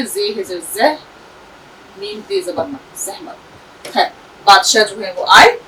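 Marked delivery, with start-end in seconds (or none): 0.0-3.4 जहमत spelled again as जे जर, मीम ते जबर मत, softly, near a whisper. Shown as a demonstration. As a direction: repeat the spelling of जहमत in a hushed voice. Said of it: "फिर से देख लीजिए". (जहमत को फिर से धीमे स्वर में हिज्जे करना)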